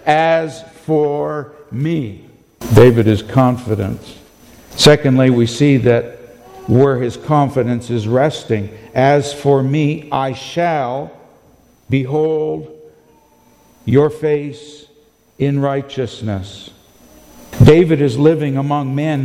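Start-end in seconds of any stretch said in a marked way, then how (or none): 0.1-2.2 very slow, weighty "As for me"; drawn-out confident claim; confident declaration. Retold or imitated. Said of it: "the confidence today that says". 8.9-16.7 slow reading of the verse; scripture reading. Acted. Rereads the verse slowly.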